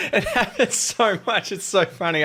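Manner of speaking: amused, giggling agreement; laughing. Real, not acted. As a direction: laugh through it as you agree. Laughing at shared joke.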